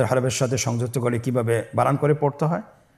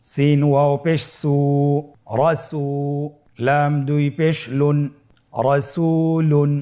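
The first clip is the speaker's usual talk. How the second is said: slow, chant-like spelling with long vowels; spelling aloud; slower than usual. Shown as a demonstration. Teacher has learners spell along, slow sustained recitation. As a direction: spell each syllable, then draw the joined word out. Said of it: "আমার সাথে বানান করে বলুন".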